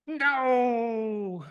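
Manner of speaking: a hammed-up angry cat shutting it down; the cat snapping no at the kitten; cat's angry no.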